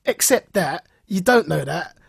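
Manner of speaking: usual speaking voice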